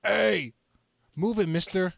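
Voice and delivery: lightly voiced New Yorker shouting; random bystander. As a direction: bark like an annoyed passerby. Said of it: "Random character number one".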